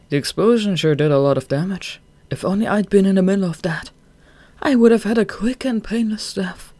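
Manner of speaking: breezy, cheerful about dying; voiced Dazai.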